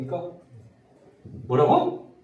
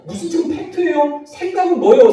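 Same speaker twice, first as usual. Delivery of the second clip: much higher, scornful rhetorical questions; scornful retort; 11 semitones higher, 4 dB louder than usual. Shown as a demonstration. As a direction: scoff at the very idea of facts. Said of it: "mocking the question". (훨씬 높은 목소리로 코웃음 치듯)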